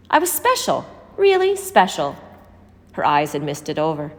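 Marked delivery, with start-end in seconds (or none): 0.1-2.2 Bertie's voice lifted high with pride; proud old memory. A narrator's reading. Bertie telling the children of her glory days, high and wistful. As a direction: savour it, dreamy and much higher.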